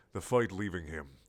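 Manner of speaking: plain reading voice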